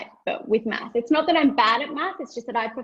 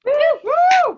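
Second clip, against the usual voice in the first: loud high whoop; cheering; 10 dB louder than usual. Real, not acted. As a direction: whoop for the comic. Cheering for comic.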